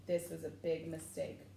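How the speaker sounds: ordinary delivery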